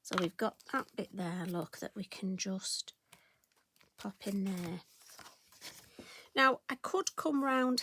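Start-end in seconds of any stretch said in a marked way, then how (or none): 1.5-4.8 quick whispered aside. Real, not acted. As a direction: drop to a breath mid-sentence. Crafter aside while stamping.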